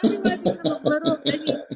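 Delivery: high, laughed-out reply; laughing. Real, not acted. Chef amused at her own French accent, laughing. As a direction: answer through real laughter.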